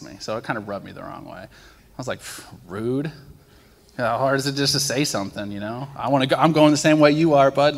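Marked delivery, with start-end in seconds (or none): 2.2-7.8 mock frustrated. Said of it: "I was just super frustrated".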